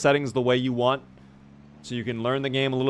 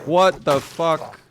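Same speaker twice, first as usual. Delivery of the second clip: startled; 4 semitones higher, 6 dB louder, much slower than usual. Real, not acted. Startled by zombie.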